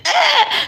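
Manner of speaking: high mock witch shriek; brief high screech of a horror witch; witch scream. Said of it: "she starts screaming".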